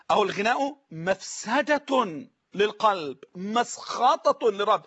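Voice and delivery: slow, مسخطة drawn out; slow repeat. Shown as a demonstration. Preacher restating the saying for viewers, slow and deliberate. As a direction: read it again carefully. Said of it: "كلام واصل".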